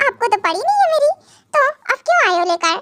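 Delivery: sulky reproach. Acted. Wife refusing the food her husband brings. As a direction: hurl the complaint quickly, offended.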